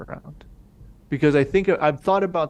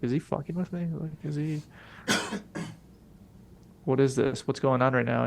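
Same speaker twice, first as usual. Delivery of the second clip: paranoid muttering; 6 dB quieter than usual. Retold or imitated. Paranoid while high, inner voice.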